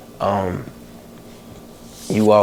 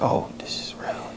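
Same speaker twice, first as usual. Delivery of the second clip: whispered realization; 12 dB quieter than usual. Retold or imitated. Retold moment of shock on phone.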